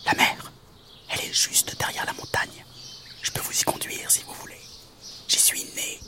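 soft whispering mantis; toute petite voix. Acted. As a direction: whisper it all as a tiny insect. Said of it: "une toute petite voix". (toute petite voix chuchotée de mante)

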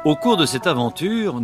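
plain reading voice

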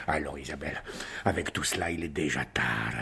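low and soft, tired; husband worn out, speaking softly; weary low voice. Acted. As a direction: sink into weariness. Weary baron heads to bed.

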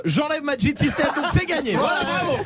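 host laughing as he drops the losing player. Real, not acted. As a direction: announce it while cracking up. Host jokingly removes losing player.

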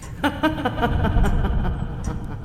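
high, sly cackle; hammed-up witch snicker. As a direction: snigger like a witch. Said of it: "se mit à ricaner".

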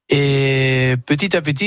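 usual speaking voice

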